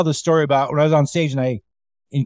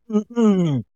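imitated heckler's laugh, pitched higher; mock the joke with a higher laugh; 5 semitones higher than usual. Heckler laughing at every joke, imitated higher mocking laugh. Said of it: "every time a joke would happen".

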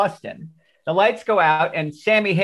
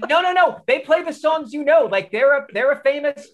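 put-on frantic pleading kid; 7 semitones higher than usual. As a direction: beg him not to leave, voice jumping up. Kid pleading to stay at concert.

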